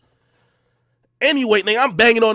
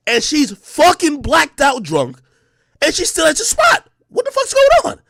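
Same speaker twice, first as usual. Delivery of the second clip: building angry shout; 8 semitones higher, 5 dB louder than usual. Retold or imitated. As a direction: pitch climbing to a furious yell. Rising angry rant.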